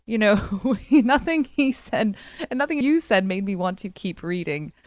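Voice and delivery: ordinary talk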